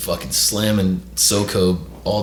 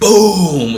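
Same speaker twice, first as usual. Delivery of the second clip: higher, louder drawn crash noise; loud imitated boom; 10 semitones higher, 8 dB louder than usual. Fire department kicks the door open, loud imitated boom. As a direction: boom out the impact.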